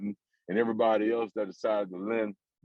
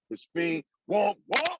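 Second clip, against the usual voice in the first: catchphrase; 11 semitones higher than usual. Acted. Signature sign-off catchphrase.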